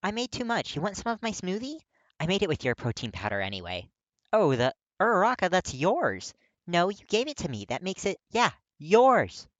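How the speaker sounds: put-on high, loud, cheerful girl voice; Uraraka's voice. A narrator's reading. Uraraka offering Kirishima her smoothie, high and playful. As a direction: lift into a bright, teasing girl's voice.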